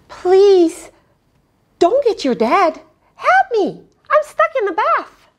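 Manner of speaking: high, pleading small voice; pleading little spider. Acted. Stuck spider begs the boy for help, high and small. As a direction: beg for help in a tiny voice. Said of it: "spider pleads".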